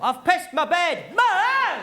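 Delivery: high, loud, slow wail for Mum; emotional child's call. Shown as a demonstration. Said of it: "there's always more emotion in that call".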